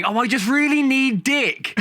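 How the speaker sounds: slow, high, hammed-up sigh; panto innuendo. Panto actor bent over the counter, slow camp line. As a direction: camp and suggestive, drawn out. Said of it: "bend over a counter and say".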